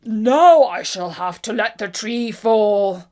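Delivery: loud, slow giant's cry; a straining giant calling out loud. A narrator's reading. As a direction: bellow it out, slow and heavy. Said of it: "and cried".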